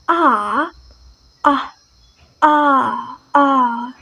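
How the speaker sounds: "ah" as a long open British vowel; British A sound. Shown as a demonstration. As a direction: hold "ah" long, from the back of the mouth. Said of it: "the British pronounces like".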